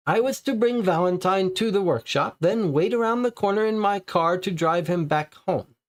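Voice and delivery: high, anxious suspect voice; nervous suspect. Acted. Papineau answers the police, as a high nervous suspect. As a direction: play the frightened informer, pitched well up.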